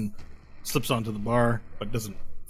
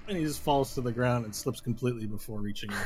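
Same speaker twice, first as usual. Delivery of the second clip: normal talking voice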